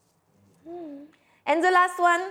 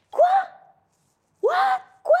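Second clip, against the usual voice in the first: high, astonished little outburst; mock disbelief; 3 dB louder than usual. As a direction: play up the shocked "what?". Mock disbelief that lesson continues. (incrédulité feinte)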